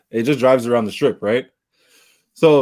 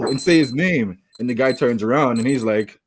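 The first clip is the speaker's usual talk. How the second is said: usual speaking voice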